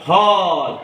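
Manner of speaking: "hall" with a long, drawn-out aw; open aw sound. Shown as a demonstration. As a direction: model "hall", holding the aw vowel. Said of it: "ए चा उच्चार अ असा करायचा". ("hall" मधला ऑ लांबवून)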